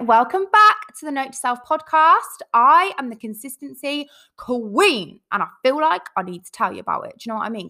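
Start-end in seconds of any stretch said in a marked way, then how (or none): 0.0-2.4 bright, high and loud hello; excited greeting. Real, not acted. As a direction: greet your listeners with a burst of energy. Bubbly podcast greeting.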